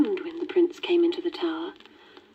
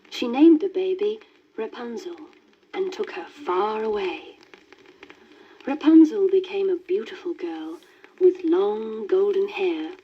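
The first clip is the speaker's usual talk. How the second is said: ordinary delivery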